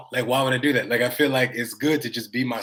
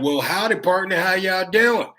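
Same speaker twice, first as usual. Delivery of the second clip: mock Texan howdy, pitched up and slowed; Texas accent; 7 semitones higher, 4 dB louder than usual. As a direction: play a fake cowboy greeting in a Texas accent. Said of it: "went to Texas and was like".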